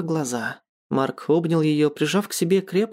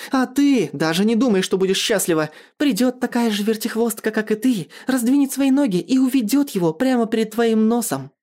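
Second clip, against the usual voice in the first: high-pitched, vindictive warning; angry tirade; 7 semitones higher than usual. A narrator's reading. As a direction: hurl the curse in indignation. Furious tirade after slapping him.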